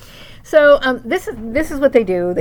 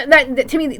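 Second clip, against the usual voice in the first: usual speaking voice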